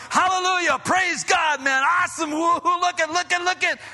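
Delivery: high-pitched, exuberant shouts of praise; noisy rejoicing. Acted. Healed man leaping into the temple, rejoicing noisily. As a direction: burst out as if just miraculously healed. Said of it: "suddenly there's this noise".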